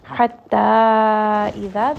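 loud, very slow chant of "Hatta idha"; مد أربع حركات; recited four-count mad. Shown as a demonstration. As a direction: hold the long vowel for four counts. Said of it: "this is going to be a four count mad".

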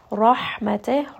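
"Rahmateh" with a heavy ra and airy ha, slowly; tajweed demo; slow recited rahmateh. Shown as a demonstration. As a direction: give the ha plenty of air. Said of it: "ra here is going to be heavy".

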